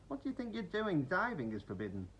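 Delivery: high indignant scolding. A narrator's reading. Duck scolding the frogs, indignant. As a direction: tell them off.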